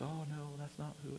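soft, feeble imitation of tearful denial; mock crying. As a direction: imitate a defendant weakly crying her innocence. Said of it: "She starts crying. She goes".

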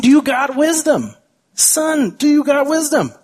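father pressing his son about wisdom; urging father. Retold or imitated.

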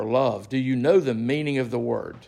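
ordinary talk

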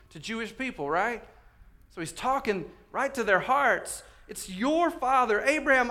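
high, loud sermon delivery; impassioned preaching. Acted. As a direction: preach it out with rising force. Preaches at full force.

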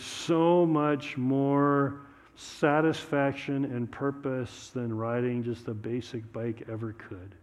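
earnest, measured pastor's prayer; closing prayer. Real, not acted. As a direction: lead the room in prayer.

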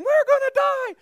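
high loud wail; mock panic. Pagan sailors fearing the storm, mock panic.

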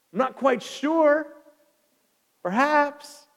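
high-pitched, drawn-out doubt; acted uncertainty. Jonathan unsure whether the Lord will act, high and uncertain. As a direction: waver aloud, stretching it out. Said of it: "you see faith and you see uncertainty".

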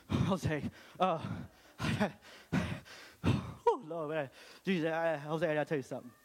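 panting and gasping between words while running; hammed-up winded runner puffing to a friend; out of breath. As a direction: gasp the words out mid-run like you're jogging. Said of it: "It was more like".

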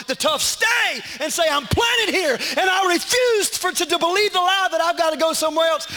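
climbing from firm to a full preaching shout; building sermon cry. Acted. Pastor rousing the congregation, building to a shout.

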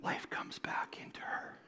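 breathy whisper of wonder; softly whispered awe; hushed awe. Acted. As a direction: whisper it like a miracle. Jairus's daughter raised to life.

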